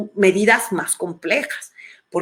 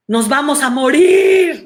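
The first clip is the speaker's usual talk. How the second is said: higher, louder alarmed cry; imitating a helpless, frightened person; mock panic; 5 semitones higher, 6 dB louder than usual. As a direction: blurt it out in dread.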